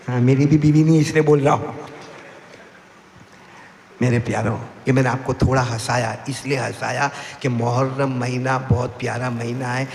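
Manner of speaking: ordinary talk, nothing marked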